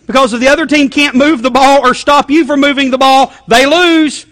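high, loud, building to they lose; excited pundit. Retold or imitated. Preacher playing football analyst for the church, loud and excited. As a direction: hammer it home like a sportscaster.

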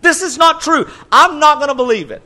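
high, loud stubborn protest; stubborn denial. Retold or imitated. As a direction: insist it isn't true, up high.

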